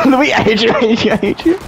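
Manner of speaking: whiny complaint. Real, not acted. Frustrated at teammate.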